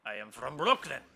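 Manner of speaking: loud put-on French accent. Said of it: "With a French accent".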